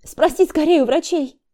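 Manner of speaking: high, urgent plea; anxious urging. A narrator's reading. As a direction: push him to ask the doctors. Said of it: "Обеспокоенно посмотрела она".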